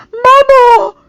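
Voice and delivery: loud, high, desperate cry for mother. A narrator's reading. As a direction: cry out for her, breaking. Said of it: "he cried desperately".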